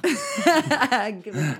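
slow laughing plea. Real, not acted. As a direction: laugh it out slowly. Laughing at a joke.

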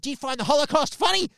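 high, loud, outraged question; indignant demand. Retold or imitated. Angry Jim grilling Mark over the joke, high and loud. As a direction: demand it like an offended boss. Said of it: "He's like".

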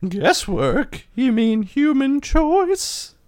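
Margot character voice. Acted.

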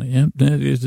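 normal talking voice

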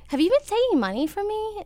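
retold question, pitched well up; hesitant confrontation. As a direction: ask it high, bracing for the answer. Confronts her dad on the phone.

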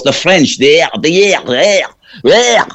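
THERE drawn out in a high, guttural voice; mock-French THERE with an exaggerated throaty R; guttural French-style sound. Shown as a demonstration. Comedian tracing the accent to French, THERE said in exaggerated guttural fashion. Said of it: "It's very guttural".

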